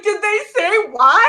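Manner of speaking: high, loud, laughing question; laughing quote. Retold or imitated. Imitating herself on the phone to her managers, laughing. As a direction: ask it while cracking up. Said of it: "I was like".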